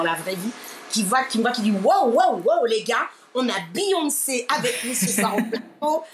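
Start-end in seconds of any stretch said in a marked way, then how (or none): 1.8-6.0 retold hyped greeting. Columnist announcing a [name] to the team, retold hype. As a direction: hail a star, imitating an impressed colleague.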